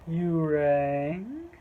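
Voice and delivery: slow, loud put-on voice swooping upward; mock-solemn, drawn-out question; imagined summoned manager. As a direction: answer a summons like a creepy butler. Imagined manager popping from secret door.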